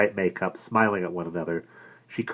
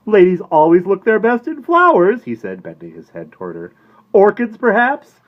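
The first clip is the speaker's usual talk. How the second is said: oily salesman; 13 semitones higher, 12 dB louder than usual. A narrator's reading. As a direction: flatter to sell. Florist selling.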